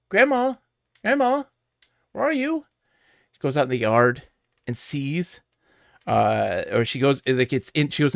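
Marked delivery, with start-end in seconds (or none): 0.1-2.6 higher, mock worried calling out; calling for grandma. Retelling a game trailer, calling for grandma in mock worry. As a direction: call into the house like a worried granddaughter. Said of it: "and, like, you know".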